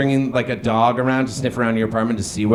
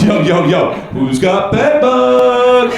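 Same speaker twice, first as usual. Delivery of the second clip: loud, high crowd-rousing yell with a drawn-out finish; mock party-host holler; hype shout; 9 semitones higher, 6 dB louder, much slower than usual. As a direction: shout to rally the crowd, stretching the last word.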